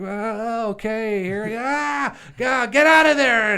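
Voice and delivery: loud, high-pitched panicked yelling; hammed-up high scream over a movie chase; mock horror-film screaming. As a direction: imitate screaming film victims, building to a full shout. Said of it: "We just heard people screaming and running".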